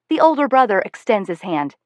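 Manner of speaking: ordinary delivery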